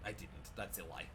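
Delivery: put-on hammy Count Chocula vampire accent. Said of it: "That's Count Chocula".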